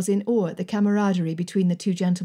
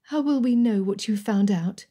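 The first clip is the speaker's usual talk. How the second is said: ordinary delivery, nothing marked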